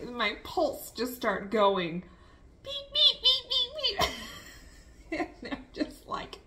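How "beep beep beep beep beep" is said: high mock monitor beeping; heart monitor beeps. Imitating the monitor as her pulse races, high beeps. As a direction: squeak out the beeps like a machine.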